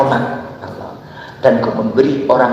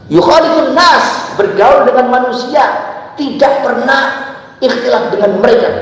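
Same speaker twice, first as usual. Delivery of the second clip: emphatic preaching; 10 semitones higher than usual. Acted. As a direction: lean on every phrase. Raised preaching.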